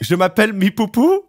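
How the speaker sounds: imitating learner's French. Drunk stranger repeats her French phrase, imitated learner's French. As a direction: repeat it as an earnest French beginner. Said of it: "she says it again".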